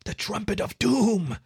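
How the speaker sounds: high, slow, ominous utterance; awed mouthing. A narrator's reading. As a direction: draw it out like a dreaded name. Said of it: "Davy mouthed".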